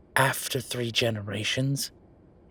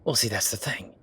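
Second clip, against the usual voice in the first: whispered aside. Acted. Aside in calm explanation.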